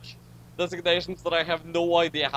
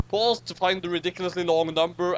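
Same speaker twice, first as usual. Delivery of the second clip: ordinary talk, nothing marked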